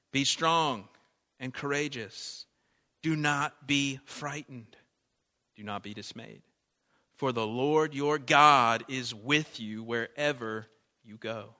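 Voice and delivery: loud, slow scripture proclamation; booming, slow reading of the command; scripture read aloud. Acted. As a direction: proclaim the verse with weight. Reading verse with force.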